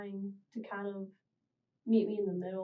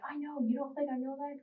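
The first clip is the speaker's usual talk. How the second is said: mild mock yell, pitched higher; higher-voiced retort, only lightly imitating a yell; retold yell; 6 semitones higher than usual. Teen retelling yelling back at mom, mild retold yell. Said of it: "I'd yell back".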